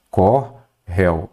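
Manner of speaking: "Corréu" slowly split as cor... réu; syllable by syllable. Shown as a demonstration. Teacher showing the old spelling, "Corréu" slowly in two parts. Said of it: "Antes era assim".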